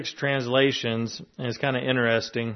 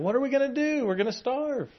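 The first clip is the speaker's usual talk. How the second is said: much higher, hammed-up alarmed Israelite; mock panic; 12 semitones higher than usual. Israelites hearing Moses about the land Sabbath, in mock panic. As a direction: squeal it like a panicked farmer.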